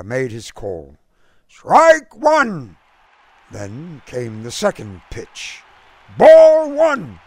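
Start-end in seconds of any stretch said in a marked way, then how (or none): 1.5-2.8 much higher, loud umpire shout; umpire's call. A narrator's reading. The umpire calls the pitch to the crowd, loud shout. 6.2-7.2 much higher, loud umpire shout; umpire's call. A narrator's reading. The umpire calls the pitch to the crowd, loud shout. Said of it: "the umpire cried".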